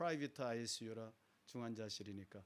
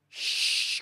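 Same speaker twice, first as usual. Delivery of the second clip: sharp whispered shh, breathy and hissed; a wolf-faced nurse whispering shh; wolf nurse hushing; 12 dB louder than usual. Retold or imitated. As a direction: hush him like a creature guarding the room. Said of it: "그러는 거예요".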